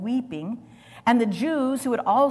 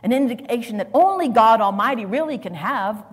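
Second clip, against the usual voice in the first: rising preaching force; 2 semitones higher, 7 dB louder, faster than usual. Acted. Preacher building on God's indignation.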